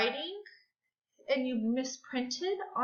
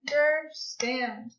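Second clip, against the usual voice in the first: "Misunderstand" in four even, separate beats; clapped syllables. Shown as a demonstration. Teacher trying the word again for pupils, syllable by syllable. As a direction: chop "Misunderstand" into mis, un, der, stand. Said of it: "Let's try that again".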